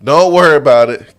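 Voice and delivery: high, loud firm no; wife objecting. Retold or imitated. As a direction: cut him off sharply as the wife. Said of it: "His wife was like".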